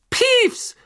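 high, loud furious call; angry shout. A narrator's reading. Angry professor calls up to the poltergeist, furious high shout. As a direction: yell it up at a troublemaker in anger. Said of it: "rief eine zornige Stimme".

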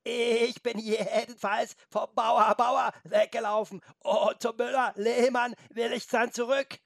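high, slow, drawn-out bleating goat voice. A narrator's reading. Goat mocks the humans who penned it in, bleating. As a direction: stretch and wobble the vowels like a goat. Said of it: "meckerte die Ziege".

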